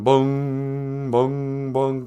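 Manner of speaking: sustained monotone bell-like booms; slow, held "boom" chimes; imitated clock chime. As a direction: toll like a striking clock, drawing each boom out.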